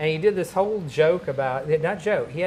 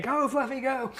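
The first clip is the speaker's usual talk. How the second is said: much higher, eager urging of a dog; cheering on the dog; 10 semitones higher than usual. Retold or imitated. Kids urging the dog on, much higher cheering. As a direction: squeal encouragement at the dog. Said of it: "and they go".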